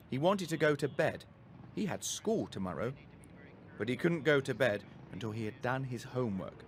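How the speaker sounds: ordinary delivery, nothing marked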